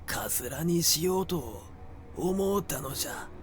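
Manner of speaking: put-on high, cawing old hag voice; a withered crone explaining herself in a crow's voice; crow-like croak. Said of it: "カラスの鳴くような声が、あえぎあえぎ".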